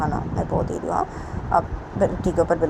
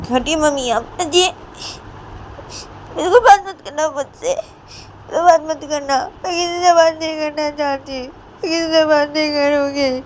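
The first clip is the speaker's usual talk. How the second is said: hammed-up sobbing tantrum; crying Sonam; 10 semitones higher, 3 dB louder than usual. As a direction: push her away in a tearful, high-pitched whine. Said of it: "Sonam वो इधर पे रो रही थी".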